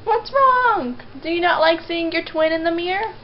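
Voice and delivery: high, loud coo to the dog. Real, not acted. Owner asks her dog what's wrong, cooing high.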